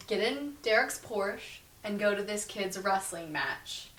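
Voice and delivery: usual speaking voice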